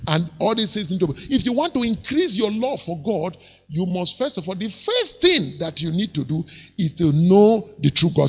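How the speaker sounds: ordinary talk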